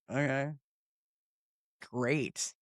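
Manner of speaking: dry unimpressed okay, soft. Retold or imitated. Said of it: "I'm like".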